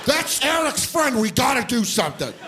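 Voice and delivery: high, urgent frantic pleading; panicked dad. Retold or imitated. Dad in bed pleading with his wife Martha, high and frantic. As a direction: beg your wife to act, frantic.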